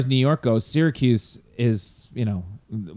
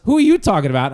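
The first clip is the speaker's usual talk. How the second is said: louder, higher retold question; mock indignant question; 6 semitones higher, 6 dB louder, faster than usual. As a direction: act out the friend's puzzled demand. Retold argument at Nixon game.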